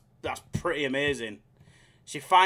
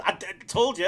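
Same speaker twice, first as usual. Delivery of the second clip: ordinary delivery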